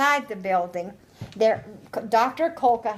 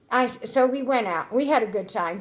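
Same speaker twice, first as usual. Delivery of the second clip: ordinary talk, nothing marked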